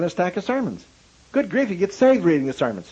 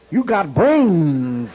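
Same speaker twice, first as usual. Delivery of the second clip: put-on southern accent; 4 dB louder, much slower than usual. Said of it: "He had a southern accent a foot thick".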